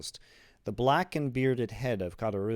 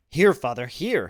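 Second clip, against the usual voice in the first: raised, louder and higher voice; eager urging; 4 semitones higher, 9 dB louder than usual. A narrator's reading. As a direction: press it on him eagerly. Son urgently offers father money.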